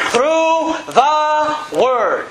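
very slow, word by word; deliberate, spaced-out scripture answer; emphatic answer. Real, not acted. Answers own question from scripture.

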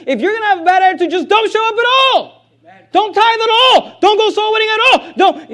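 very high, loud pulpit shout; strained-high, emphatic exhortation; full-force preaching. Real, not acted. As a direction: drive each command home at a shout.

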